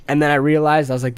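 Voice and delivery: normal talking voice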